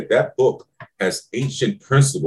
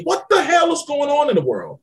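shouted what the hell, much higher; exasperated shout. Real, not acted. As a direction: throw the question up in frustration. Exasperated about young people.

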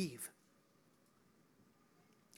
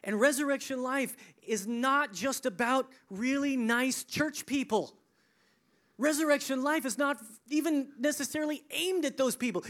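loud, raised preacher's pitch; forceful preaching; 6 semitones higher, 5 dB louder than usual. Real, not acted. Preacher telling church who resurrection life is for, forceful preaching. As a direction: drive each phrase out to the crowd.

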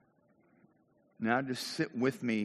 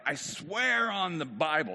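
slow, emphatic oath, pitched up; acted vehement swearing; 4 semitones higher, slower than usual. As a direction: stretch SWEAR as a solemn vow. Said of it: "he said it with an oath".

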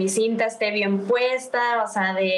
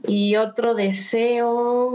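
usual speaking voice

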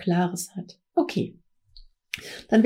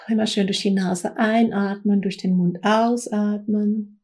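right in your ear. Acted. Meditation opening, close to the mic.

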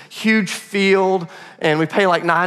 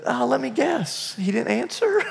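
mocking; 5 semitones higher, 6 dB quieter than usual. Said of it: "the dude just starts mocking me".

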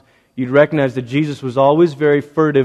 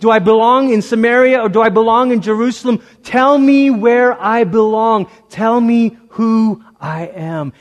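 pleading woman; 8 semitones higher, 4 dB louder than usual. Acted. A woman pleading with Jesus to tell her who she is. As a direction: beg to know where she belongs.

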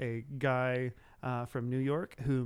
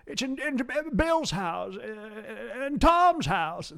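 put-on high, drawling old-film star voice; Jimmy Stewart; 8 semitones higher, 5 dB louder, slower than usual. Shown as a demonstration. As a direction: stammer it out like a classic movie hero. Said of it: "my horrible, uh, yeah, Mister Stewart".

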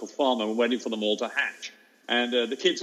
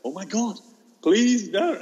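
retold dread; 8 semitones higher, 5 dB louder, slower than usual. Teacher dreading the bin's discovery.

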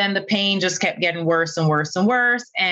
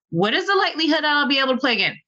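higher and rushed, pressing harder; urgent repeat; 8 semitones higher, much faster than usual. Retold or imitated. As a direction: push the question in alarm. Presses surgeon again, more urgent.

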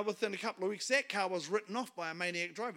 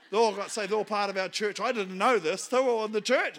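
retold astonishment; 8 dB louder than usual. Acting out his astonishment at news.

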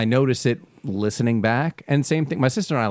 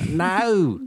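ordinary delivery

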